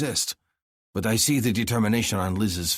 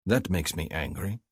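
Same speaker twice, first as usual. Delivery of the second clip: usual speaking voice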